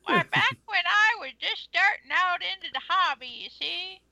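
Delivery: grumpy grandpa telling tales; old man voice. Pretend. Old man bit continues.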